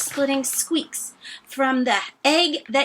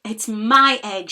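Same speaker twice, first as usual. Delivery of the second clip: sputtered; 6 dB louder than usual. Acted. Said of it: "she sputtered".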